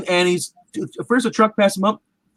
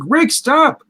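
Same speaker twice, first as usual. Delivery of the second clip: higher and louder sharp call; shouted command; 9 semitones higher, 5 dB louder than usual. Retold or imitated. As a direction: shout to stop him cold. Courtney shouting to stop Rick.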